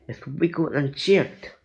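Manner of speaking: plain reading voice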